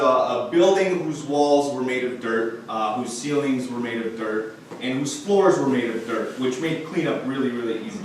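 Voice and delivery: ordinary delivery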